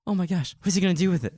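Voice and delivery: high, fast, thrilled retelling; excited gush. Retold or imitated. Host retelling seeing a new Lucas teaser, fast and thrilled. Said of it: "I was so excited about it".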